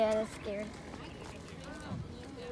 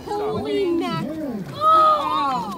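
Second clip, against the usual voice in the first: amazed gasp; 2 semitones higher, much slower than usual. Real, not acted. Child sees big catfish landed.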